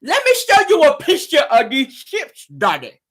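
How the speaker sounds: put-on high, slow lisping child voice, a thick tongue; mock kid voice asking daddy; lisping little girl. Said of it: "That thick tongue came on in there".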